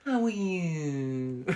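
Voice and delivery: slow, drawn-out gushing presenter voice; syrupy daytime-telly sweetness; Holly impression. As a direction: coo it slowly like a TV host. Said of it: "give us a Holly impression".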